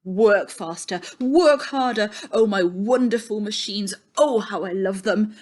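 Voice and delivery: loud, eager factory-owner shouting; a man in love with his machines, shouting; excited cries. A narrator's reading. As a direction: cry it out, thrilled with the machines. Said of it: "with Ernie's excited cries".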